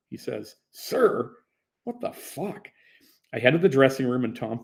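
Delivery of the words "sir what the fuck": indignant protest. Retold or imitated. Indignant at being called sir.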